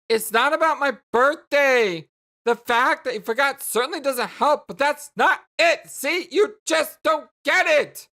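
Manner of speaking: high, loud, frustrated protest; angry rebuttal. Acted. Jane telling Jake he misses the point, angry. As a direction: push back hard in exasperation.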